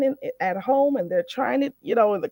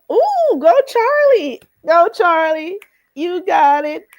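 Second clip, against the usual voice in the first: cheering; 7 semitones higher, 6 dB louder, slower than usual. Real, not acted. Cheering learner's success.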